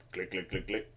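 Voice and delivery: very soft, low, evenly spaced clicking; imitated machine clicks. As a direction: mimic a dial clicking under your breath.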